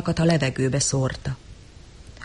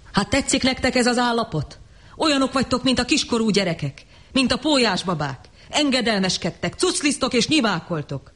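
fiery preaching savage; 6 semitones higher than usual. Acted. Savage harangues the crowd.

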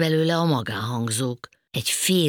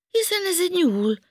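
very high, louder astonishment; amazed; 5 dB louder than usual. A narrator's reading. Panka spotting a rabbit, amazed. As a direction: exclaim it high in wonder. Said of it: "ámuldozott Panka".

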